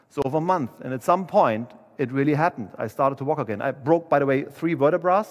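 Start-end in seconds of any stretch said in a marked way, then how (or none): none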